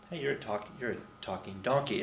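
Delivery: soft, dumbfounded mumble; stunned Balaam. Retold or imitated. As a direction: mutter it in disbelief, hushed. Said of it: "Balaam's like".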